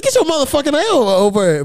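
high-pitched, exasperated summons; exasperated call. Retold or imitated. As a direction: call him over in high-voiced disbelief. Calls his uncle away, retold.

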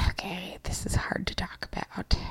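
hushed confessional whisper. Real, not acted. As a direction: whisper it like a secret. Confessing a secret affair.

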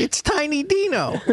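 put-on tiny kid voice. Playing childish versions of themselves.

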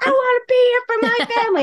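high, strained plea, hammed up; mock passionate contestant. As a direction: beg like a reality show contestant.

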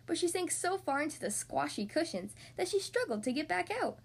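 ordinary delivery

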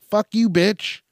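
high, loud pretend outburst; mock angry heckle. Imagined heckler insulting dress wearer.

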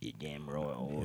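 slow, low mock English accent; softly drawled put-on English accent. Still trading lines in English accent.

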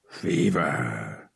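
low, drawn-out curse; cursing. A narrator's reading. Captain feeling the boy's hot forehead, cursing. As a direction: spit it as an oath, pitched low. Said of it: "he cursed".

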